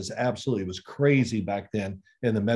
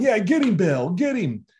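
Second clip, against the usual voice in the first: high, louder egging-on; mock cheering; 7 semitones higher, 4 dB louder than usual. Teammates egging on a yelling coach, mock cheering. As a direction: imitate players cheering the coach on.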